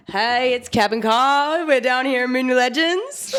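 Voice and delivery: loud, high, drawn-out show intro; mock podcast host. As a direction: open a pretend podcast at full volume. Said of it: "We were gonna be like".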